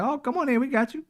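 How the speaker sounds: mock welcoming nurse. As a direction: play the overly friendly hospital greeter. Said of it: "and they're like".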